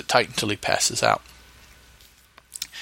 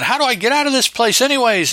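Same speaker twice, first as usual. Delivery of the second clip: high, loud, fast panicked shout; yelping in panic while fleeing; panicked henchman; 11 semitones higher, 4 dB louder, faster than usual. Acted. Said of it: "One's running around".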